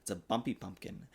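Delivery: usual speaking voice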